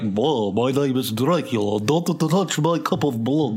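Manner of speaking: mocking hammed-up Dracula accent; put-on storybook vampire accent, mocking; fake storybook accent. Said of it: "trying to be like".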